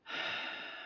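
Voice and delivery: long weary breath out; heavy sigh. Real, not acted. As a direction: let out a tired, quiet sigh. Pausing while describing feeling disconnected.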